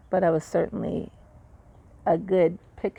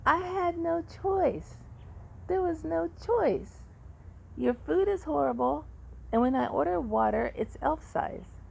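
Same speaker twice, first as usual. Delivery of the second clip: angry complaint; 10 semitones higher than usual. Retold or imitated. Patient complaining angrily to the heart surgeon about the food and water. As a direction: let the outrage climb over each word.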